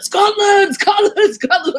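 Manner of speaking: booming high cheer of Scotland, repeated; imitating a huge shout. Friend shouting a welcome while hoisting her husband onto his shoulder, booming high shout. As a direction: bellow it like a rowdy friend hoisting someone up. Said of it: "put him on his shoulder and said".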